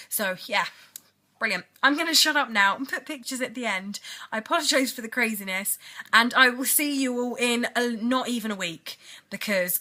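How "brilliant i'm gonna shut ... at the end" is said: ordinary delivery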